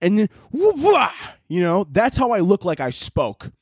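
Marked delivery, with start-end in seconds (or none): 0.0-1.4 high, stretched-out surfer whoop; surfing whoop. Retold or imitated. Telling listeners about old surfing days, a high whoop. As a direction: sing out the whoop like catching a wave. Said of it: "and I would just".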